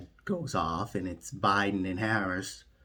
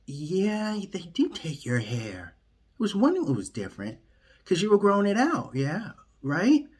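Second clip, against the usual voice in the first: high, fast, chatty put-on voice; mock kidnappers; 10 semitones higher than usual. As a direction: rattle it off high and fast like a gossiping captor. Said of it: "They're like".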